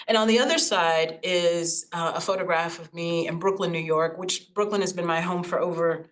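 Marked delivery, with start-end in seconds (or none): none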